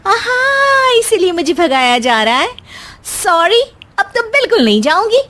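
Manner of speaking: put-on high, playful young wife's voice; teasing daughter-in-law. Daughter-in-law teasing her mother-in-law over the TV, high and playful. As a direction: tease her with a high, mocking lilt.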